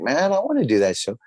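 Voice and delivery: retold keen outburst; eager longing. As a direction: blurt it out, itching to join in. Voicing own past eagerness to appear.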